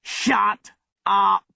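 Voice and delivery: mild narrated yell, each word separate; screamed order. A narrator's reading. Squad leader silencing Abdullah, understated scream. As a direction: cut him off with a restrained shout. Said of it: "screamed the squad leader".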